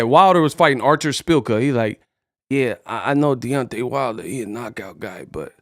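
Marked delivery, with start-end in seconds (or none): 2.5-5.5 low, very soft mumbling Tyson voice; Mike Tyson impression. Tyson unsure about Wilder's opponent, low mumbled impression.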